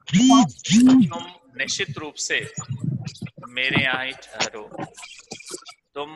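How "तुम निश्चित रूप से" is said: urgent plea. Acted. Seth urging bird to stay.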